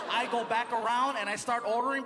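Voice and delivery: usual speaking voice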